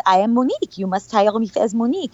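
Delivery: put-on French-accented insistence. Actress announcing herself as Monique at the audition, French accent. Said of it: "to him with my French accent".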